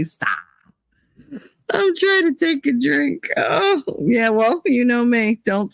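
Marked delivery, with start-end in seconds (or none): 0.0-0.3 Jersey stop. Retold or imitated. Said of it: "He said it the Jersey way".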